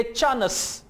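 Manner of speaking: चानस with a Punjabi extra vowel, cha-nas; Punjabi-style chance. Retold or imitated. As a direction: chance broken into two syllables in a Punjabi accent. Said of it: "Pakistani Punjabi style में आप इसे कहेंगे". (पंजाबी लहजे में चानस)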